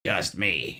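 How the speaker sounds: low, soft villain impression; Green Goblin voice. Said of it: "Green Goblin voice".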